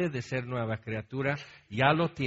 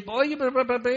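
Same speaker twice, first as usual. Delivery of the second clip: heated argument; 10 semitones higher, 3 dB louder than usual. Retold or imitated. Retelling heated argument with wife.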